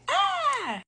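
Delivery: a sudden high-pitched squeal. Real, not acted. Vlogger squealing with excitement to viewers. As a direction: squeal out in glee.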